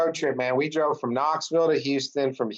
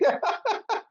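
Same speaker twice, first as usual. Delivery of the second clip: 1 real burst of laughter. Real, not acted. Laughing at the memory.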